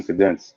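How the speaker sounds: "cadence" stressed on the second syllable; mispronounced cadence. Real, not acted. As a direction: say "cadence" as kuh-DENCE. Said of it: "Did you just say cadence".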